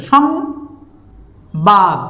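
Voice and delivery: "সংবাদ" very slowly, split into সং and বাদ; teacher sounding out "সংবাদ" in two slow pieces; syllable-by-syllable demo. Shown as a demonstration. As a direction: draw out each half of "সংবাদ" with a long gap between. Teacher sounds out example word.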